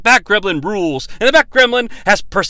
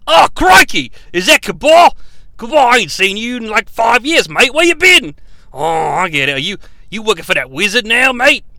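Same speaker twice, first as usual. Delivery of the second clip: put-on Australian accent; 3 semitones higher than usual. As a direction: shout it like a rowdy Aussie bloke. Kano voiced, Australian, shouting.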